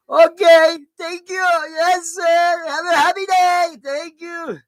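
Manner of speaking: high, loud, sugary polite voice; sweet mock politeness. Cabbie answering the cursing driver, high sugary politeness. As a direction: overdo the cheerful niceness.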